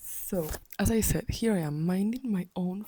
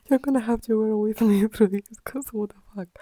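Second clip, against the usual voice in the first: laughing through the words, a bit higher; real incredulous laughter breaking in; laughing disbelief; 4 semitones higher, 3 dB louder, faster than usual. Real, not acted. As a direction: laugh helplessly as you apologise. Laughs in disbelief while apologising.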